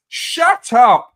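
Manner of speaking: telling smug fans to stop, loud; loud shut up. Real, not acted. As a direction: bark it at the fans.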